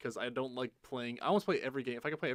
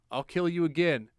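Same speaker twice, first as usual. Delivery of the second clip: louder, higher defiant threat; mock threat; 4 semitones higher, 8 dB louder than usual. Player answering the necromancer who came back, mock threat. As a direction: throw it out as a loud challenge.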